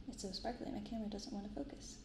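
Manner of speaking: ordinary talk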